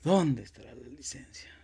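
whispered to himself; anxious whisper of a lost license; whispered worry. Retold or imitated. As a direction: whisper it anxiously while searching.

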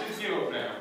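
breaking up with laughter; words squeezed out through giggles; laughing. Real, not acted. Groom laughing mid-speech.

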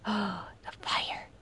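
sharp gasp then a whisper; whispered gasp at the fire. Real, not acted. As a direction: hush in sudden realization. Gasping discovery in horror game.

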